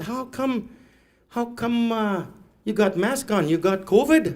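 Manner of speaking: high-pitched imitation of nosy locals; puzzled questioning. Locals question his mask, high puzzled voice.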